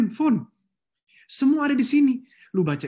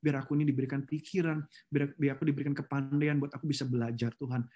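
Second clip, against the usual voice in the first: soft, lower, humble plea to God; humble prayer; 5 semitones lower, 5 dB quieter than usual. Shown as a demonstration. Preacher models a humble prayer asking God for wisdom. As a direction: pray it quietly and humbly. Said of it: "minta sama Tuhan bahwa".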